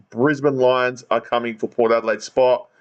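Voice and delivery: usual speaking voice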